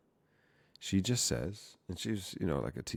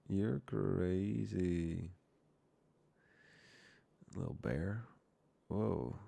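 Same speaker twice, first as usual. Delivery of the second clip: ordinary delivery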